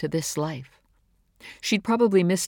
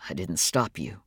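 low, rough man's voice; 10 semitones lower, 4 dB quieter than usual. A narrator's reading. David answering Caroline's accusation, in a rough voice. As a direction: drop low for a roughened reply. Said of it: "His voice was rough".

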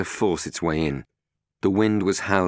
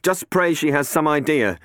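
higher, louder resigned voice; Matt resigned; 11 semitones higher, 6 dB louder than usual. Acted. Matt telling Catherine it's hopeless, resigned. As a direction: give up on arguing.

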